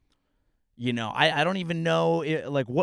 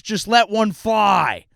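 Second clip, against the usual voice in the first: mock rant; 7 semitones higher, 9 dB louder, slower than usual. Comic rant to listeners.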